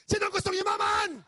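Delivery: big high shout to the crowd; rallying shout. Shown as a demonstration. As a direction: call it out loud to rouse the audience. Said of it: "pag isinigaw ko kung sinong gustong yumaman".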